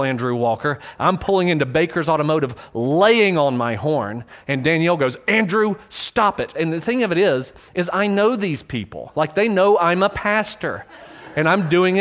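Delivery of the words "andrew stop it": higher, slow warning; hint of a wife scolding; stern wife. Wife telling Andrew to stop honking, stern.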